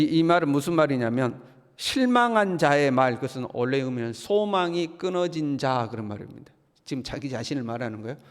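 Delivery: ordinary talk, nothing marked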